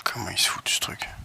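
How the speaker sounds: unvoiced puzzled whisper. Real, not acted. Quiet aside while installing.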